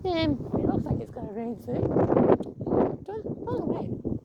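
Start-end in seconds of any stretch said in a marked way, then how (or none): none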